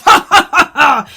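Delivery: loud, hammed-up evil laugh; mock villain laugh. Vlogger mocking the prince's villain reveal, hammed-up cackle.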